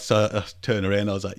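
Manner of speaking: ordinary delivery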